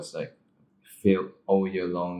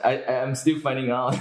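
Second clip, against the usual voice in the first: laughing; 6 semitones higher, 4 dB louder than usual. Real, not acted.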